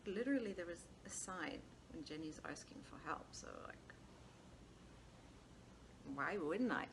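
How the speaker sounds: normal talking voice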